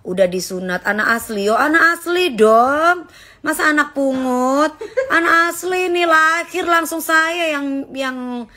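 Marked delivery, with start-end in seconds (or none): 1.5-3.1 slow, high and loud insistence on anak; insisting proudly. Real, not acted. Bangga tegaskan anak kandung. 5.1-7.9 anak punched high and loud, drawn out; proud emphasis. Real, not acted. Bangga tegaskan anak kandung.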